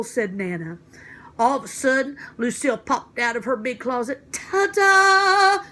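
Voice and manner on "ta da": grand ta-da. Acted. Lucille makes a grand entrance.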